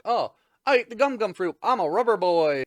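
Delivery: loud, high, excited announcement; hammed-up loud, high reveal; mock proud boast. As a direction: blurt it out like a boastful cartoon hero. Hero boasts to foe, retold.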